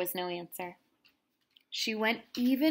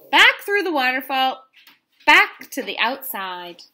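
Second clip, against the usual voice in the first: excited narration; 6 semitones higher, 9 dB louder than usual. A narrator's reading. Fleeing from the dark.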